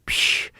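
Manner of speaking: sharp "psh" noise; vocal sound effect of landing; imitated whoosh. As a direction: make a quick hissing rush.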